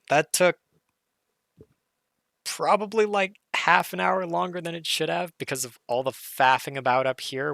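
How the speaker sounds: high and loud with excitement; bursting with delight; excited. Real, not acted. Thrilled after finishing the puzzle.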